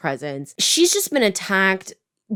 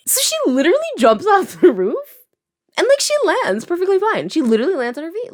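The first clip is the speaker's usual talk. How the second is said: high, loud and fast with a laugh; giddy high-pitched storytelling; excited; 12 semitones higher, 4 dB louder than usual. Real, not acted. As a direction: gush about the jump. Excited retelling of jump.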